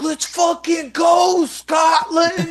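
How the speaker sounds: huge high shout, dragged out slowly; full shout. Retold or imitated. Fighter bursting through the fire door at Scotland fans, full slow roar. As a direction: roar it like kicking the door open. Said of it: "kicks the fucking fire door open".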